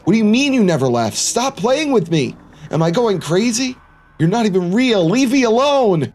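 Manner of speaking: high, frantic acted protest; agitated outburst. Narrator telling the figure to stop playing, agitated. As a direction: plead and demand, pitch staying high.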